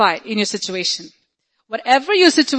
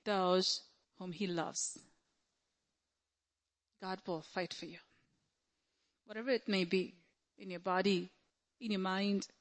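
very soft, measured assurance; hushed preaching; 3 semitones lower, 13 dB quieter than usual. Real, not acted. Preacher assuring believers God will fight for them, hushed. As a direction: bring it right down and let each phrase settle.

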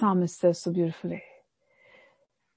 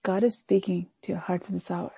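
usual speaking voice

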